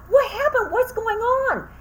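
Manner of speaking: high, loud frantic questions; frantic alarm. Retold or imitated. Asking hysterical students what they saw, frantic and loud. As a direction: shout it out in a scared rush. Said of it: "And I was like".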